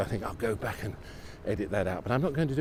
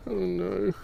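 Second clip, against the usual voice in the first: high-pitched oh no after laughing; giggly dismay; 10 semitones higher than usual. Real, not acted. Walker stuck on the way down, giggly dismay. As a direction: say it through the tail of a giggle. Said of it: "Stop laughing so much".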